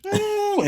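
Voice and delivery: high-pitched mock whimper; imitated crying whine. Retelling how the boy started crying, high mock whine. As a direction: mimic a child's high wail. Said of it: "he just started crying".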